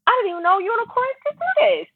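very high-pitched astonished onlooker; mock amazement. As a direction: play awestruck at a rare sighting. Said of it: "and then you like".

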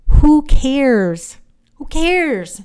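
loud, high scoff at her own purse; dismissive. Real, not acted. A woman scoffing at her own purse, dismissive and loud. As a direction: brush it off loudly, who cares.

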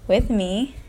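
normal talking voice

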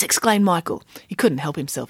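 ordinary delivery

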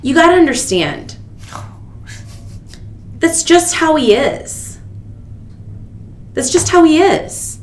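a mocking impression of the manager brushing off her complaint; mansplaining manager. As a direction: talk down to her like a patient know-it-all. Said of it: "hallmark of the mansplain".

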